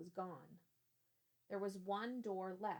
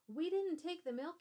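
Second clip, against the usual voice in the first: high, loud frightened blurt; 9 semitones higher, 7 dB louder than usual. Acted. Boy caught peeking, blurting in fright. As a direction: blurt it out in a panic. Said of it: "he blurted".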